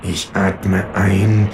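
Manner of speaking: slow, through gritted teeth; grimmig. A narrator's reading. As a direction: force calm, barely holding it. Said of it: "sage ich grimmig". (grimmig, sehr langsam)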